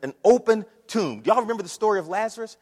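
normal talking voice

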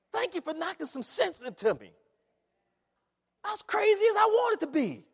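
high, loud preacher's cry; rising revival preaching; full-force preaching. Acted. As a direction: preach it out to the church.

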